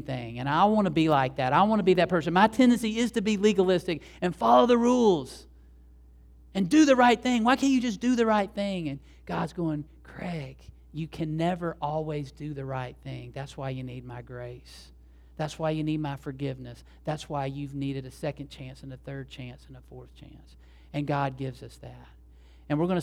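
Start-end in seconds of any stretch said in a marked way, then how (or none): 2.3-8.9 high, insistent rule-follower; pitched-up, self-mocking insistence; legalistic nagging. Acts out his own legalistic nagging. 10.0-20.6 soft, patient correction; gentle God's reply. Acted. Voices God gently answering him.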